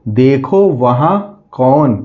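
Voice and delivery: slow, drawn-out reading of देखो वहाँ कौन; slow reading aloud. Shown as a demonstration. Teacher reading the decoded sentence to children, slowly. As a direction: spell it out slowly for the children, word by word.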